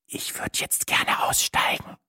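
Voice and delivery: slow, soft whisper; hushed, voiceless plea. A narrator's reading. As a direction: whisper it timidly and slowly. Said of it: "flüsterte ich".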